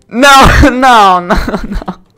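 high, loud, half laughing; loud despair. Real, not acted. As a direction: wail it out in disbelief. Loses the game.

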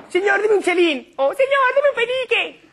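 very high, slow urchin's plea; squeaky, loud child beggar voice; begging child. Acted. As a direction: beg like a little Dickensian orphan. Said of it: "las cosas que dicen los niños".

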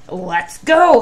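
loud honk-like call; honking goose. Acted. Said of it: "honked Goosey Lucy".

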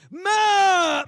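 high, loud drawn-out yell; mock tantrum shout; whining kid. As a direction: holler for mother like a pestering child. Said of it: "y'all got a kid like that".

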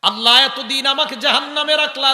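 loud, high sermon delivery; full-force preaching. Acted.

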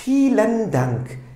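deliberate teacher pronunciation demo; slow model reading. Shown as a demonstration. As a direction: say it slowly so the class can repeat. Teacher models the German phrase.

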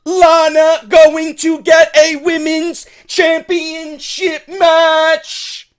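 loud, high, slow, stretched-out complaint; mock outraged wrestler. Vlogger voicing a wrestler berating Shane McMahon, loud hammed-up outrage. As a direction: ham up an indignant diva yelling at the boss.

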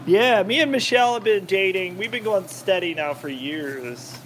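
normal talking voice

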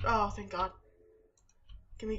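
normal talking voice

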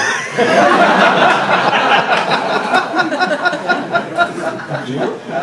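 long, high burst of laughter; big laugh. Real, not acted. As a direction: laugh hard at your own mess. Laughs after being fired.

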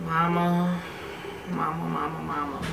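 soft, fading calls; softly pleading. Retold or imitated. Daughter softly calling her mother before confessing.

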